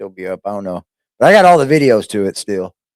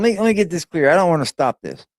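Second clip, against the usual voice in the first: ordinary talk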